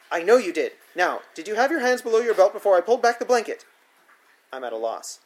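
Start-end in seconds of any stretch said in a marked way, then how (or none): 0.1-3.6 louder, higher insistent demand; angry interrogation. A narrator's reading. Sitter refusing his answer, loud angry demand. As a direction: bear down on him.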